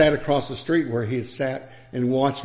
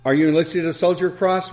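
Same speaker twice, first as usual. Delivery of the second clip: ordinary delivery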